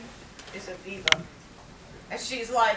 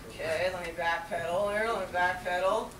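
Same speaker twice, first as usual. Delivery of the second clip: ordinary delivery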